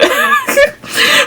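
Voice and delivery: squealing laugh; high burst of laughter. Real, not acted. Laughing at the baby's head showing.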